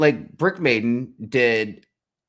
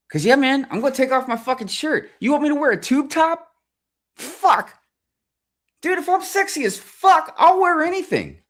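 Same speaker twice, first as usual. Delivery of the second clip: very high, loud falsetto boast; mock bragging; 6 dB louder than usual. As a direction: crow it in an over-the-top squeal. Joking boast about getting fit.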